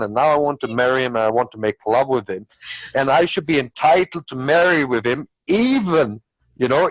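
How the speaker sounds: ordinary delivery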